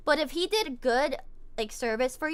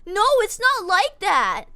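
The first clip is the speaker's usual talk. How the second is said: defensive customer; 7 semitones higher, 7 dB louder, slower than usual. Retold or imitated. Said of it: "the customer's like".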